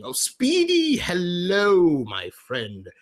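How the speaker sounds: high, loud, drawn-out hello; exuberant greeting. Real, not acted. Streamer hailing a viewer who joins the chat, exuberant greeting.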